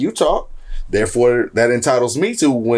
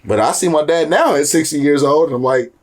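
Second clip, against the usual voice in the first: ordinary talk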